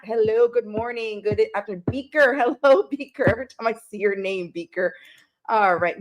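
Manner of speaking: ordinary delivery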